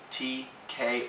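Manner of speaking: ordinary talk, nothing marked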